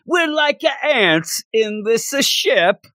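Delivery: high, slow mock Italian accent; a comedy Italian priest voice, drawn out; Guido Sarducci voice. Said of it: "I sound like Guido Sarducci".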